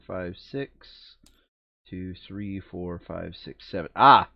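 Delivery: usual speaking voice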